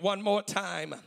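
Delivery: ordinary talk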